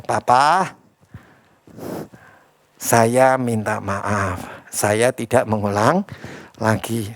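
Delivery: soft, slow sorry to papa; child's apology. Retold or imitated. Child apologizes to father after discipline, soft slow contrition. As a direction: say sorry quietly like a guilty child. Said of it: "minta maaf sama siapa".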